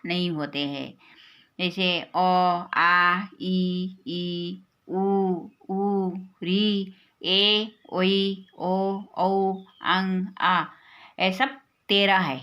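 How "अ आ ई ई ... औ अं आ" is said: each vowel held long and level; slow letter recitation. Shown as a demonstration. Teacher listing the vowels for children, slow measured recitation. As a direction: recite the vowels one by one, drawn out.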